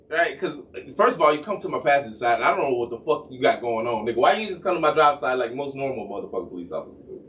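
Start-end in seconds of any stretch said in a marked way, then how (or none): none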